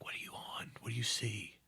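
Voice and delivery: sneaky hunter's whisper. Retold or imitated. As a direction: whisper so the prey won't hear. Sneaking up behind hunting guide.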